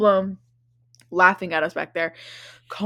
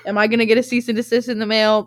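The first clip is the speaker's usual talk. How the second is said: louder, theatrical fretting about the letter; mock worried question; 3 semitones higher, 5 dB louder, faster than usual. Shown as a demonstration. Podcaster fretting to listeners, in mock worried tone. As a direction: ham up the dread of a lawsuit. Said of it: "I say that every week".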